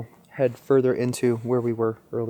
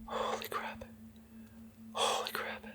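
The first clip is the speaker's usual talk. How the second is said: whisper; 21 dB quieter than usual. Real, not acted. Reacting after seeing eyes.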